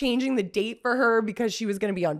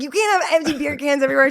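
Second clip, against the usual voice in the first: laughing retort; 6 semitones higher, 4 dB louder, faster than usual. Retold or imitated. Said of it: "'cause I was like".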